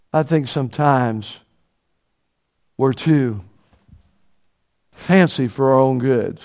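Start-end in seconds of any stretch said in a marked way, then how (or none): none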